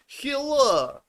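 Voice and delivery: high, hammed-up made-up foreign accent in broken English; goofy made-up accent. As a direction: clown around in a silly foreign accent to make his wife laugh. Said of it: "I don't know what accent it is".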